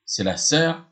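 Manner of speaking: ordinary delivery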